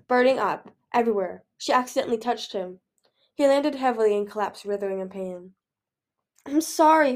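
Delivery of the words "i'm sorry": higher, louder, anguished apology; pleading cry; distressed cry. A narrator's reading. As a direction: cry it out in remorse. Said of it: "Peril cried, landing behind him".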